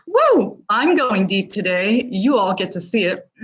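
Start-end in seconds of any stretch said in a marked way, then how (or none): 0.1-0.6 high breathy whoo to shake off tears; a real, tear-choked woo; teary whoo. Real, not acted. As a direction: blow out the emotion with a high woo. Fighting back tears live.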